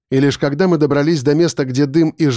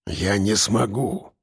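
low, slow and soft; dull muffled reply; 6 semitones lower, 7 dB quieter, slower than usual. A narrator's reading. Chained man answering his rescuer, dull and muffled. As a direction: answer dully, dragging the words down. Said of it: "глухо ответил он".

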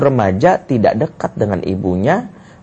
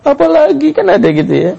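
high-pitched whiny husband, mock distress; melankolic husband; 13 semitones higher than usual. Melancholic husband cuts off his wife, high mock distress. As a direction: imitate an over-sensitive husband, pitched way up. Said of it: "suaminya lebih melankolik".

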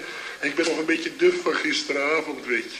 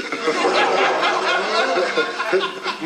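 loud, rising burst of laughter; big laugh; 4 semitones higher, 5 dB louder than usual. Acted. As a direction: roar with laughter at the disaster. Laughs at smashed window.

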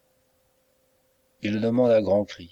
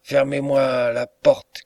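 slow, louder, higher cry; narrated shout; 5 semitones higher, 5 dB louder, slower than usual. A narrator's reading. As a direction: shout the order to lock the door. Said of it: "crie Bark".

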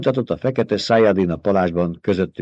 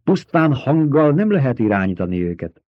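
ordinary delivery

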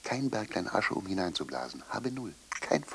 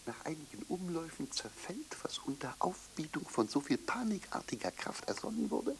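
much softer, toneless whisper; 9 dB quieter than usual. Acted. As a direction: let it crumble away. (leises Flüstern)

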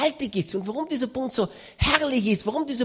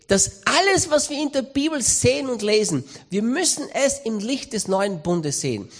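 normal talking voice

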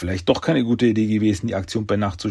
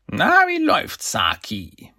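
schriller Auftakt, dann abfallend; hoch einsetzen, beim Namen fallen lassen; Krang; 11 semitones higher, slower than usual. A narrator's reading. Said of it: "Cranks Qualmkopf wurde sichtbar". (verstellte Stimme eines körperlosen Gehirns)

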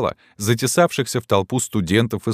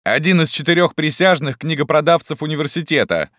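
high, raised heckle; eager chiming in; 9 semitones higher than usual. A narrator's reading. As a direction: pick up the taunt from another student. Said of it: "подхватил другой".

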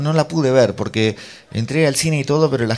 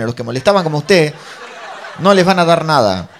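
raised, loud mock scolding; 5 semitones higher, 8 dB louder than usual. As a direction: put a troublemaker in their place. Mujer reprende a otro espectador. (regaño imitado, fuerte y agudo)

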